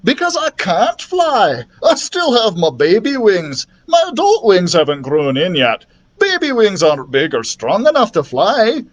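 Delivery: storybook dragon confessing; sheepish dragon. A narrator's reading. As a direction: explain it plaintively. Voices the dragon.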